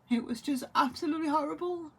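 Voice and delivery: real tears rising in the throat; voice breaking with grief. Real, not acted. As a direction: grief cracking the voice. Grieving, never said goodbye to mum.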